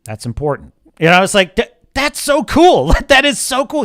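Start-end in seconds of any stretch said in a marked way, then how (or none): 0.0-0.7 low, soft, unhurried reassurance; calm and steady. Retold or imitated. Superman calmly assuring Lois, low and soft. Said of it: "very calmly says". 1.6-3.8 delighted. Real, not acted. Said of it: "fan delight".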